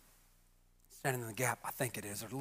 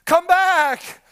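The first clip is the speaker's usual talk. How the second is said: higher and louder, crying out; retold scream; 8 semitones higher, 7 dB louder, much slower than usual. Brother [name] retelling how he screamed in the dream, loud cry. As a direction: call him back desperately, half-acted. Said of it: "I just screamed".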